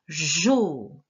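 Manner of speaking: JO with drawn-out J and O; dictation sound. Shown as a demonstration. Teacher showing gestures for a syllable, stretched-out sounds. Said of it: "je te montre les gestes".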